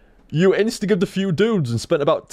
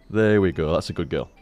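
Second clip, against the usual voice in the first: soothing cat; 8 semitones lower than usual. Real, not acted. Calming the cat.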